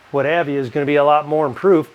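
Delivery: ordinary talk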